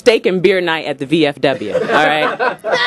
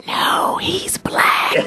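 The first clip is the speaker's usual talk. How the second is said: whisper; 4 dB quieter, much slower than usual. Retold or imitated. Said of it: "she whispers".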